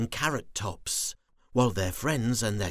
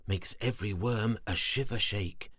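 ordinary delivery, nothing marked

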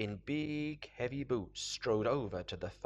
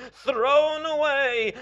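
high, loud, drawn-out flourish; triumphant call; 13 semitones higher, 14 dB louder, slower than usual. Acted. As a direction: stretch it out loudly and high. Character relishes throwing the day away.

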